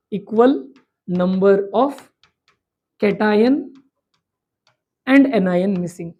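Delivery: word by word, long pauses between chunks; measured dictation, stopping after every word or two; slow dictation. Shown as a demonstration. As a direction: dictate it slowly for note-taking. Teacher dictates a definition slowly.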